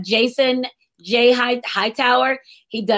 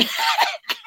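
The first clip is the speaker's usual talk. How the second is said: high laugh; 3 dB quieter than usual. Real, not acted. Laughing at book club reply.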